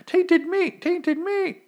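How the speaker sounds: extremely high squeal, chanted twice; crazed taunting voice. Retold or imitated. Bitten man taunting the cannibals who ate him, mock falsetto. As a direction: imitate a maniac in piercing falsetto. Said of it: "I don't know if he did that voice".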